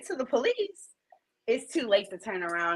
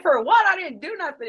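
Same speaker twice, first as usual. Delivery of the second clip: indignant protest; 8 semitones higher, 4 dB louder than usual. Retold or imitated. Client protests the demand.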